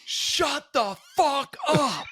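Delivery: high, slow, shouted disbelief; mock outraged shout. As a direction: yell it like the office firebrand.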